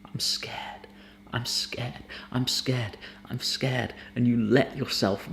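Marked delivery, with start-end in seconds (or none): 0.0-3.9 hushed whisper building into soft voice; acted fearful confession, whispered then voiced; scared thought spoken out. Shown as a demonstration. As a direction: start barely audible, gradually find your voice. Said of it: "you start speaking that thought out".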